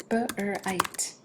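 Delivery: "B-R-I-T" as b, r, long i, t; tapped out. Shown as a demonstration. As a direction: break "B-R-I-T" apart sound by sound. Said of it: "have you tap it out".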